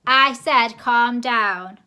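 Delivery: slow, very loud shout. A narrator's reading. Posh man shouting at the deaf grandma, slow and very loud. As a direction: bellow each word. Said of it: "she shouted".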